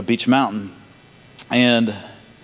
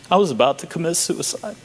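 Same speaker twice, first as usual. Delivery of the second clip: higher, tearful retelling; choked up; 5 semitones higher than usual. Real, not acted. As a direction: get through a painful confession while close to tears. Tearfully retelling a man's suicide confession.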